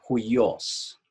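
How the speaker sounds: huios said slowly as two syllables, HUI-os, stress on the first; Greek word huios. Shown as a demonstration. Teacher shows the class how to say the Greek word, slow, stress on HUI. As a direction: carefully sound out huios for the class, slow and clear. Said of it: "se pronuncia".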